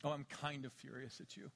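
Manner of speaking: mild mock fury. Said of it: "met someone that was kind of furious".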